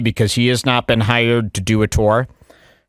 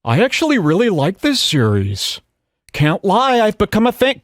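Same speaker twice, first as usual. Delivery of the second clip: mocking chat reader; 8 semitones higher than usual. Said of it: "He says".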